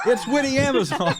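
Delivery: name the guest grandly; announcer intro. Acted. Host introducing the last guest, announcer style.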